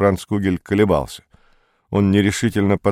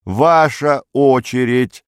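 higher, drawn-out official call; secretary insisting, every word stretched; raised voice; 4 semitones higher, 4 dB louder, slower than usual. A narrator's reading. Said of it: "повысив голос". (повысив голос, протяжно и настойчиво)

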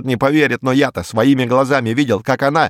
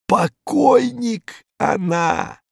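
usual speaking voice

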